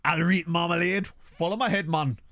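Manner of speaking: put-on Geordie greeting; fake Geordie accent. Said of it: "put on a fake Geordie accent".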